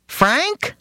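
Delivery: high, indignant father voice; father denying he shot his friend's eye out. Retold or imitated. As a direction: protest it in a high fussy father voice. Father answering son on phone.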